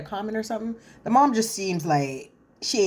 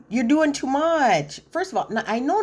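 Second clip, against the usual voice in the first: exasperated; 8 semitones higher, 3 dB louder than usual. Real, not acted. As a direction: drag it out, totally over it. Exasperated at Maxwell's demand.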